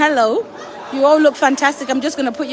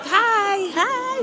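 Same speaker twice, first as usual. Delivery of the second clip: excited greeting; 7 semitones higher, 4 dB quieter than usual. Real, not acted. Greeting friends on camera.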